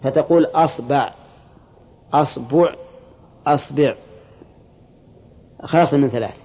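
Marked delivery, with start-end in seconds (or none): 0.5-1.1 "asba'", first and second vowel both short a; fatha on hamza and ba. Shown as a demonstration. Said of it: "الفتح". 2.1-2.7 "asbu'", opening on a with u after the b; fatha, then damma. Shown as a demonstration. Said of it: "والضم". 3.5-3.9 "asbi'", opening on a with i after the b; fatha, then kasra. Shown as a demonstration. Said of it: "والكسر".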